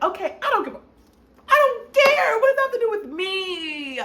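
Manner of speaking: high, loud, fed-up cry; exasperated outburst. Retold or imitated. Answering the lawyer's talk of prisons, exasperated outburst. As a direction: blow up at being told it's fine.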